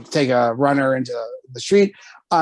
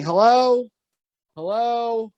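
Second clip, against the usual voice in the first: calling out; 7 semitones higher, slower than usual. Retold or imitated. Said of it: "I start yelling".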